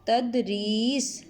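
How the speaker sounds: تدریس said slowly, each sound clear; slow model word. Shown as a demonstration. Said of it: "کیا لفظ بن جائے گا".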